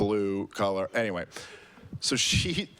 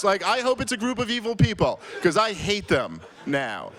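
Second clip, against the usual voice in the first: furious rant; 7 semitones higher, 4 dB louder than usual. Retold or imitated. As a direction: let the hatred boil over. Ranting at the hotel manager.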